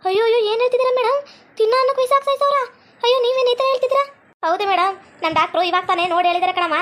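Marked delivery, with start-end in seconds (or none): 0.1-4.1 high-pitched acted alarm; shocked protest. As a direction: protest in horrified disbelief, voice raised high. Wife protests at poisoning news.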